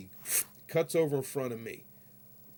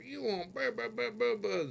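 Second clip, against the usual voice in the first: mocking cop chatter; 10 semitones higher than usual. Mocking cop lady's talk.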